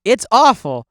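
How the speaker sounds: loud, high exclamation; mock high praise. Viewer praising a painting, loud mock admiration. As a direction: proclaim it as a grand compliment.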